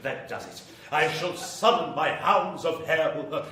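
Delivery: normal talking voice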